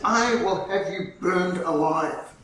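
slow, weighted threat; slow, deliberate ultimatum; exasperated threat. Retold or imitated. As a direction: spell out the threat word by word, out of patience. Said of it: "proconsul's patience was exhausted".

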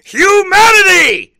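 high, booming, over-the-top declaiming; hammed-up cosmic announcement; mock grand proclamation. Mock grand proclamation about conspiracy mysteries.

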